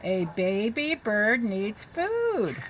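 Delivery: very slow, careful read-aloud; teacher reading to children. Shown as a demonstration. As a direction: read it slowly for the class.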